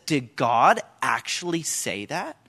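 slow, low sly question rising at the end; mocking serpent. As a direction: plant doubt like the snake, drawing it out. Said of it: "he says".

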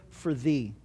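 "thee" set apart, low and soft; "for thee" as a quiet, weighted phrase; stress on THEE. Shown as a demonstration. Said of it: "and the other word".

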